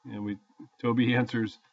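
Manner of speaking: ordinary delivery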